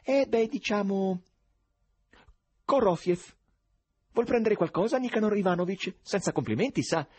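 high tenor, hesitating then breezily offering; unperturbed stranger. A narrator's reading. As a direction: play the untroubled, over-familiar oddball. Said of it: "per nulla turbato da quella severità". (sconosciuto imperturbabile, voce acuta)

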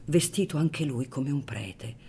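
ordinary delivery

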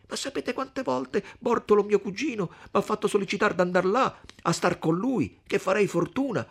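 high, animated, rushing character voice; high-pitched, impassioned outpouring; eager young suitor Renzo. Acted. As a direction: argue it excitedly, pitched well up. Renzo speaks, acted by narrator.

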